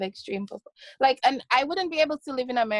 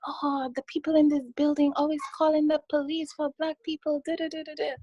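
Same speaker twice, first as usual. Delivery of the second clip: lightly voiced, higher-pitched grumbling mom; imitated complaining mom; 6 semitones higher than usual. Mom griping about neighbours calling police, imitated complaint. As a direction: mimic a mother's running complaint.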